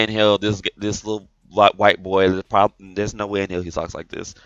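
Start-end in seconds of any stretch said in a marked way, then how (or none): none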